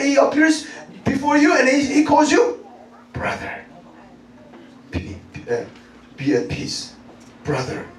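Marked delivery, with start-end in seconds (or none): 3.1-7.9 soft, low and soothing; tender greeting. Acted. Risen Jesus appears before you, soft low comfort. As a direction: murmur it low, like a gentle blessing.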